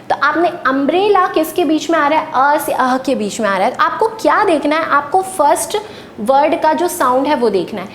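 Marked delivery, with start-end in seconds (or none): none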